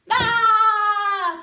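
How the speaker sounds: loud roaring bah, tongue out; lion roar. Shown as a demonstration. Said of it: "you go".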